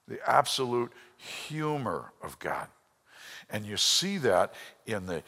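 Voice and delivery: usual speaking voice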